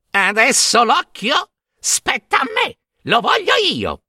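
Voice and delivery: put-on high-pitched squawking old sister; shrieking hag. A narrator's reading. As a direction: squabble in a high screechy voice. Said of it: "una delle tre strillò".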